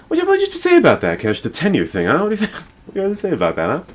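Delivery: squeaky rapid-fire teasing questions; teasing needle. Real, not acted. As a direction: poke at your friend to get an answer. Teasingly pressing friend for opinion.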